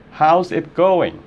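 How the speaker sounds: slow, clearly divided words; slowed contraction. Shown as a demonstration. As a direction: draw out "How's it going" for learners. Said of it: "você pode dizer assim". (contração dita devagar)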